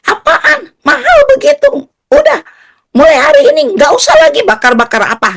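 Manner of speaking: high, loud indignant rant into the phone; exasperated, high-pitched telling-off; grumbling dad. Retold or imitated. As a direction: imitate a father griping in outrage. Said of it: "Lalu Papa saya ngomel-ngomel".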